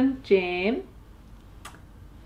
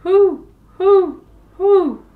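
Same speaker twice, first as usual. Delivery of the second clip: high, loud owl hooting; 7 semitones higher, 10 dB louder, slower than usual. Acted. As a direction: hoot like an owl in the night.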